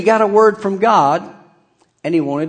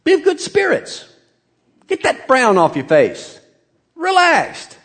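cheerful paraphrase. Retold or imitated. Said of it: "He's actually saying".

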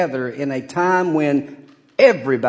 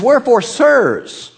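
rallying cry; 4 semitones higher than usual. Acted. Preacher voicing Paul's rallying cry.

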